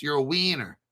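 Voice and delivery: put-on a light Latin American accent on wiener; joking Latin American accent, lightly done. Host teasing crypto viewers, light Latin American accent. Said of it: "I'm just using my Latin American accent".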